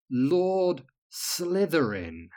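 very slow, savoring each word; tasting the words. Acted. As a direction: roll the name around thoughtfully. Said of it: "tried the words on her tongue for taste".

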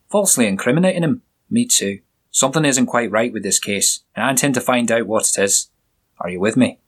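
a hint of a jovial Scottish burr; Scottish accent. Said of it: "with a strong Scottish accent".

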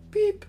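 high, steady beep; imitated electronic beep. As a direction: mimic a keypad beep. (korkea piippaus)

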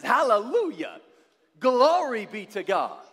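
church cheer. Retold or imitated. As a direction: praise out loud like a fired-up congregant. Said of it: "He says".

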